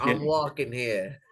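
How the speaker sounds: a Canadian imitating a New Yorker; mock New York accent. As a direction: ham up the New York cabbie line. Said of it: "where they try to do New York and they sound like Bostonians".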